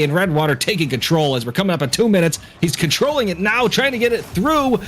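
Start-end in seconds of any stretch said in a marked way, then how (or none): 2.6-4.8 breathless, excited high call; winded, pushing the words out; out of breath. Real, not acted. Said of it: "I'm running out of breath".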